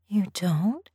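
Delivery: sweetly innocent rising question. A narrator's reading. Woman playing innocent with her master, sweetly. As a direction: lift it up coyly at the end. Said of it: "I said sweetly".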